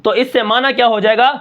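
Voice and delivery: normal talking voice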